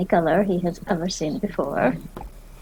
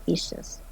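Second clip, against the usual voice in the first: long suffering sigh; 5 dB quieter than usual. Acted. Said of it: "gives a long suffering sigh".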